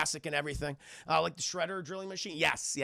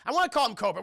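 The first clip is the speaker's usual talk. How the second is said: usual speaking voice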